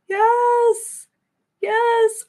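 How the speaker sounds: very high, loud yes; delighted high yes; excited squeal. Real, not acted. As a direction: squeal your agreement. Excited affirmation.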